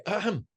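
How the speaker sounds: mock throat-clear. Student interrupting the sheikh's prayer with a pointed ahem. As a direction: clear the throat to get attention. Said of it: "in a voice".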